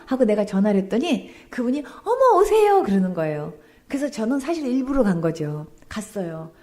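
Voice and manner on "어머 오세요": high, loud surprised welcome; delighted greeting. Retold or imitated. Deaconess greeting the caller, delighted and high. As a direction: gush a happy hello on the phone.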